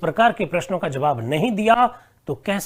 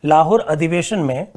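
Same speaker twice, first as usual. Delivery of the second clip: dictating word by word with long pauses; 2 semitones lower than usual. Acted. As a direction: read the question out for students to write. Dictates a question slowly.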